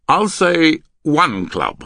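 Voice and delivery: loud, high, slow stretch on one club; deliberate coded bid. Acted. Man signalling his hand to partner, deliberate. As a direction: draw the bid out with a lilt before one club.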